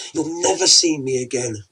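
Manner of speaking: ordinary delivery, nothing marked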